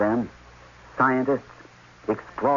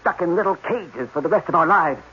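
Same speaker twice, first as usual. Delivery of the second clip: high, loud rising panic; agitated outburst; 8 semitones higher, 4 dB louder than usual. Acted. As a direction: rant it out, near hysteria, slowing on the last words. Panicked scientist ranting about captivity.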